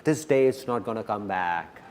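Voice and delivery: low, quiet repeat of "This day is not gonna come back"; soft echo. Shown as a demonstration. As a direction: drop to a hush for the repeat. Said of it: "repeated softly".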